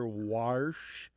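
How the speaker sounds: "warsh" said as "wahrsh", rising at the end; dialect warsh. Shown as a demonstration. Host demonstrating dialect variants, open AR pronunciation. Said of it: "you do hear".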